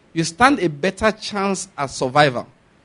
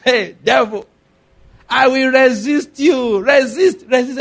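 raised, high-pitched mock rebuke of the devil; drawn-out, higher mock battle cry; mock shouting; 7 semitones higher, 3 dB louder, slower than usual. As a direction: imitate someone shouting down the devil. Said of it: "Not by shouting".

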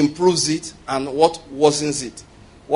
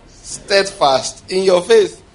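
slow, emphatic; 3 semitones higher, 5 dB louder, much slower than usual. Real, not acted. Preacher drilling the congregation on steadfast faith, slow and emphatic. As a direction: drag each word out slowly. Preacher stressing key phrase.

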